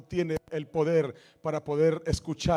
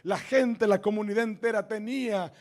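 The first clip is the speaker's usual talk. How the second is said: rising, louder preaching build; fervent sermon climb; 5 semitones higher, 5 dB louder than usual. Real, not acted. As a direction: drive the promise home to the church. Pastor builds to declaration.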